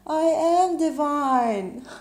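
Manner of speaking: high, loud, drawn-out proclamation; long joyful holler; yelling back. Acted. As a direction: shout it back slowly to the mountaintop. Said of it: "this time we all yell back".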